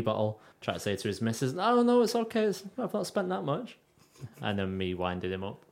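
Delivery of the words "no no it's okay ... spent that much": mock pleading husband. Voicing friend to his wife.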